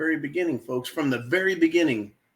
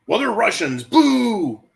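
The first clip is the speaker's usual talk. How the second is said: higher, louder hammed-up boo; mock jeering crowd; 7 semitones higher, 5 dB louder, slower than usual. Mocking the world's attitude to Russians, higher and louder. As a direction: jeer like a smug crowd, louder.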